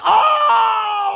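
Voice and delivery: long, high, loud whoop; sudden awestruck holler; demonstrated shout. Acted. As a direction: burst out with a stretched cry of wonder. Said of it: "like that".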